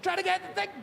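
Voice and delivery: high, hurried protest; flustered explaining. Retold or imitated. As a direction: defend yourself, caught out and flustered. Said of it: "And I'm like".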